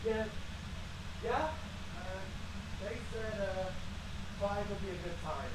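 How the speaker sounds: distant, off-mic phone talk; talking away from the microphone; far from mic. Real, not acted.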